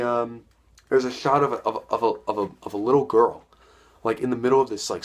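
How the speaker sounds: ordinary delivery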